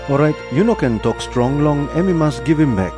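usual speaking voice

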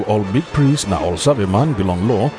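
usual speaking voice

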